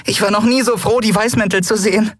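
high, relieved character voice; relieved exclaim. Acted. Nelen to the crew after the rescue, relieved and high. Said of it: "stieß die angestaute Luft aus".

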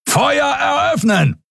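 high, slow, shouted order to fire; commanding shout. A narrator's reading. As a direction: bark the command across the bridge. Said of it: "Thrawn wandte sich ab und befahl".

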